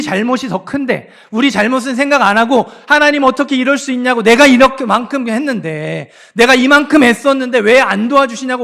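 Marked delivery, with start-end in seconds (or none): 2.9-8.6 loud, high, aggrieved outcry; mock complaint to God. Preacher voicing believers blaming God, loud grievance. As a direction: voice the congregation's grievance, rising hard. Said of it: "원망하는 거".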